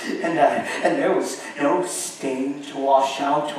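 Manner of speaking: ordinary delivery, nothing marked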